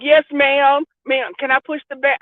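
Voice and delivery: put-on high, sweet little-kid politeness; put-on sweetness. Child sweet-talking grandmother to dodge punishment, mock sweetness. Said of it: "I'd be so sweet and shit".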